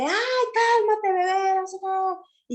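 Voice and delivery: high, slow, exasperated mock yell; mock yelling mother. Shown as a demonstration. Stressed mother yelling at her crying baby, mock shriek. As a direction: shriek at the baby to calm down, hammed up. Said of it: "una mamá que está a los gritos".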